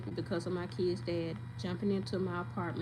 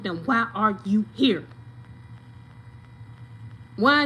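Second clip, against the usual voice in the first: loud, high and furious; angry rant; 4 semitones higher, 7 dB louder than usual. Real, not acted. Daughter yelling at her aunt over her sick mama, furious. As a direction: let the anger boil over, shouting.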